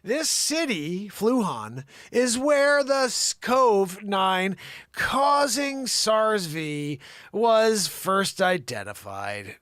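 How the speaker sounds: loud, high, over-punched newsreader; dramatic news read. Acted. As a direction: read it out like a booming report. Reads a news report aloud.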